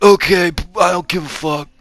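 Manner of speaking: high, put-on movie-star voice; Cage impression. Cage brushing off the hero talk, high hammy impression. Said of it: "That's my Cage".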